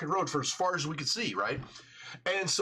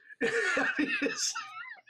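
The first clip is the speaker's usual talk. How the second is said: squeaky giggling laugh; high-pitched laugh. Real, not acted. Laughing at the memory.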